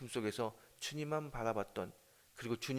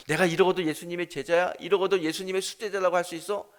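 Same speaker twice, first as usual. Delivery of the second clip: loud, higher anguished cry at himself; self-reproach; 5 semitones higher, 9 dB louder than usual. Acted. Peter berating himself after the denial, loud and higher. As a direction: shout the blame at your own failure.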